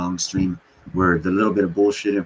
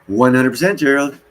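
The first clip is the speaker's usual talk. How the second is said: excited agreement; 7 semitones higher, 7 dB louder than usual. Real, not acted. Loud agreement with chat.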